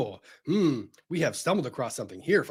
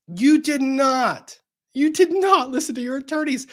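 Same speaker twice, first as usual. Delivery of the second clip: emphatic rant; 10 semitones higher than usual. Acted. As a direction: hammer each word in exasperation. Ranting at SBF.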